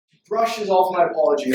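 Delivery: normal talking voice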